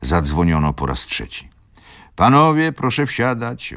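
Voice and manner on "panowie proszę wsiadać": much higher, louder call down the platform; conductors calling. A narrator's reading. Conductor calls passengers onto the train, loud and high. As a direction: hail the passengers to board. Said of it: "wołali konduktorzy".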